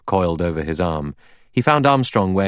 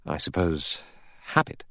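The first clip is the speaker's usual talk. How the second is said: softer, hesitant guess; quiet, unsure answer; doubtful; 7 dB quieter than usual. A narrator's reading. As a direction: offer it doubtfully, pausing midway. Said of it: "Armstrong said doubtfully".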